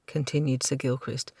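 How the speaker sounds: usual speaking voice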